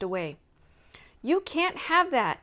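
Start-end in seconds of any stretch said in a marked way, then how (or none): 1.2-2.4 loud, slow cry chasing a thief; cried out. A narrator's reading. Nancy crying out to the thief running off with the box. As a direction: shout it after him, higher. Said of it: "Nancy cried".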